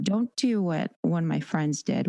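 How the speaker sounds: normal talking voice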